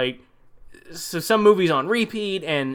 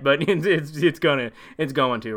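normal talking voice

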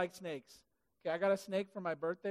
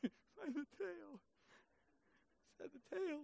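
much softer, higher, slow incredulous echo; stunned disbelief; 6 semitones higher, 13 dB quieter, slower than usual. Acted. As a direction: repeat the order, barely believing it. Moses repeats in disbelief.

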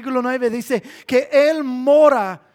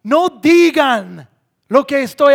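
loud, raised, stretched-out prohibition; emphatic command as Jesus; 3 semitones higher, 9 dB louder, slower than usual. Acted. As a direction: forbid it firmly, leaning hard on each word. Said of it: "Jesús dijo a las personas".

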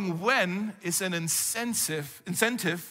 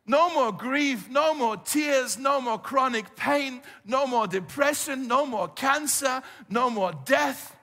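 slow, louder, higher rising litany; preaching at full force; 5 semitones higher, 3 dB louder, slower than usual. Acted. Pastor preaching the hope of Jesus's return, fervent and slow. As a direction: hammer each no more with fervour.

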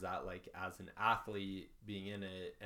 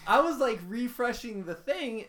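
excited; 13 semitones higher, 9 dB louder than usual. Real, not acted. Frantic retelling of missing stream.

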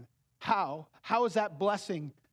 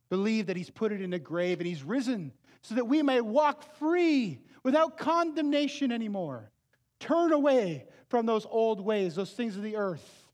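louder, pressing call; fervent exhortation; 5 semitones higher, 5 dB louder than usual. Acted. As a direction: urge them to turn away. Exhorts listeners to believe.

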